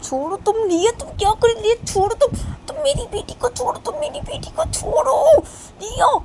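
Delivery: high, loud furious shout; frantic mother yelling, near tears; angry mother. Acted. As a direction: scream at her to let go. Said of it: "बोल रही थी कि".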